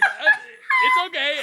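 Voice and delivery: very high, loud, scared "uh, it's okay"; nervous squeak. Retold or imitated. She reassures the stranger in her back seat, squeaking. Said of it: "I'm a little scared".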